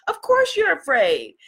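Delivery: usual speaking voice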